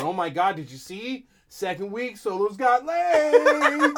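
mock excited fan. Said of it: "either a dip or".

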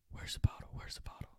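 hushed, voiceless whisper; urgent whisper. Retold or imitated. Friends in the back hunting the bottle, whispering. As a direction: whisper it so the cops won't hear.

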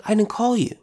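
a hint of an old priest waving the boy off; old Eli, insisting. Eli telling Samuel again he didn't call, mildly voiced. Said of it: "Eli's like".

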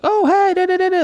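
very high, sing-song fake cheer; mock sweet drive-thru girl. Mocking the drive-thru girl's overly sweet greeting, very high and chirpy. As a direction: parody her chirpy customer-service voice. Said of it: "trying to be all, like, super sweet and friendly".

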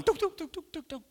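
high mimicked pinging, dropping away in volume; high repeated blips that fade; imitated submarine pings. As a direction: imitate sonar in a submarine film, fading out. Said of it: "It sounds like I'm in U five seven one".

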